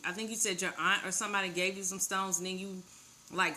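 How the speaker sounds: usual speaking voice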